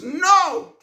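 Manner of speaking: a sharp, high exclamation of denial; emphatic no. Real, not acted. Answering his own question to viewers with a sharp high no. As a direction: fling out the No! high and hard.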